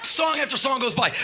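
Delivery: ordinary talk, nothing marked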